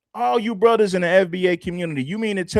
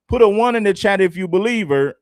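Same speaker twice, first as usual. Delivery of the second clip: ordinary delivery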